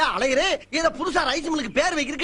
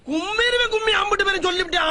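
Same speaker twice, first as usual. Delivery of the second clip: shouting; 5 semitones higher than usual. Acted. Husband yells at family.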